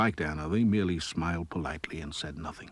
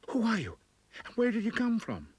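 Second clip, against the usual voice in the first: frightened whisper. Acted. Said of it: "she whispered".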